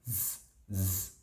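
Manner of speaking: put-on soft "Z, z", only the end sound of boys; isolated z ending. Shown as a demonstration. Teacher showing learners the word ending, bare z sound. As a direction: hiss out the bare "z" sound softly. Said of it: "ending the word with z".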